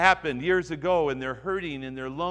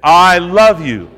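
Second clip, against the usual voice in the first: louder and slow, each word drawn out; said again slowly; 4 semitones higher, 16 dB louder, slower than usual. Shown as a demonstration. Preacher leading the congregation a second time, slow and louder. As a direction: stretch it out as a model for them. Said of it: "Say that".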